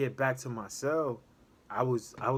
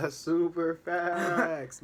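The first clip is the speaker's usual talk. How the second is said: slow, louder emphatic repeat with a laugh; 4 semitones higher, 4 dB louder, much slower than usual. Real, not acted.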